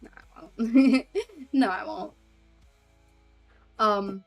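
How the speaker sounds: ordinary talk, nothing marked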